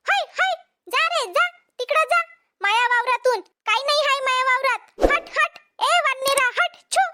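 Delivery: high shooing calls at monkeys; shooing shout. Acted. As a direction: chase them off, voice up high.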